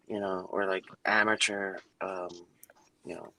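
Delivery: usual speaking voice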